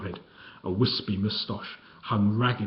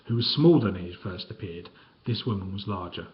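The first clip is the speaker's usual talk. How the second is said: plain reading voice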